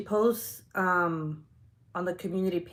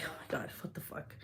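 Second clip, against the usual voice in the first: whispery, low aside to oneself; muttered dismay; 4 semitones lower, 14 dB quieter than usual. Retold or imitated. Woman realizing she accused the wrong parents, hushed dismay. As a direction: say it under the breath, nearly voiceless. Retold private dismay after mistake.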